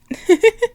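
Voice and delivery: high little giggle; tickled giggle. Acted. Princess charmed by her darling's shyness, high fond giggle.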